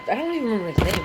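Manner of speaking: ordinary delivery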